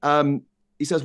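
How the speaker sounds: ordinary talk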